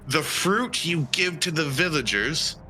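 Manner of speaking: usual speaking voice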